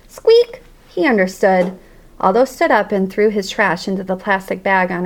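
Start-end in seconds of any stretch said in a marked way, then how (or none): 0.1-0.6 one sharp high squeak; a bright little hamster squeak; single squeak. Acted. Hamster agreeing with the janitor in a sharp squeak. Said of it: "hamster squeaks agreement".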